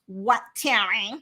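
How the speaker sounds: slow, drawn-out mock-rude reply; dragged-out, dismissive impression. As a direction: imitate an ill-mannered, put-upon retort. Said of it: "with no manners".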